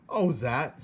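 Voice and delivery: a hint of a gruff old uncle; lightly voiced old peasant; mild grumbling uncle voice; Uncle's voice. A narrator's reading.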